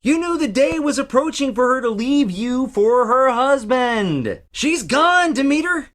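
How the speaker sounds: Hermes telling Demeter her daughter is gone; high theatrical. Acted. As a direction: lift it into a bright stage tone. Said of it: "Wow, you really got that".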